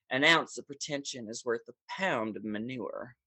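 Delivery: put-on a soft Louisiana Southern accent, quoting the Steel Magnolias line. Quoting a movie line to the host, Southern accent. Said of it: "you do that accent so well".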